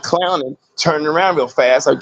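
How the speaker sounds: usual speaking voice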